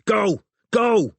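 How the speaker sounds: high, loud urgent command; bellowing. A narrator's reading. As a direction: bellow at a cab driver to move. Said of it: "he bellowed at the confused cabbie".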